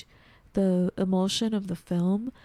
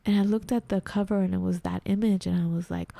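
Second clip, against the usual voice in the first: ordinary talk, nothing marked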